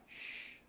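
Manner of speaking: shh whispered like hushing someone; shushing. Shown as a demonstration. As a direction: shush them quietly. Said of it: "telling somebody to be quiet".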